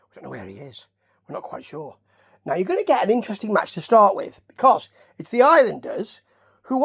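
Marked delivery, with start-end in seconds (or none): 0.1-2.0 very soft and low; muted reply. Retold or imitated. Commentators answering where he has gone, quiet low reply. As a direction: drop to a quiet, low aside.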